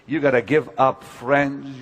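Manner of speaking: usual speaking voice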